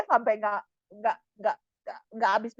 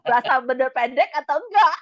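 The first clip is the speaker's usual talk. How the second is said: higher pitch, breaking into laughter; amused, laughing; 5 semitones higher, 5 dB louder than usual. Real, not acted. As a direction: laugh at your own confusion while asking. Laughs at own confusion. (geli, bertanya sambil tertawa)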